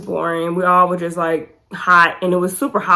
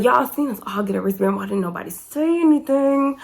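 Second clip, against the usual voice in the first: complaint climbing higher to the end; retold exasperated complaint; 5 semitones higher than usual. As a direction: act out an exasperated gripe, pitch rising as it goes. Retold complaint about the missing warning.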